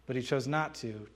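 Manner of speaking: ordinary delivery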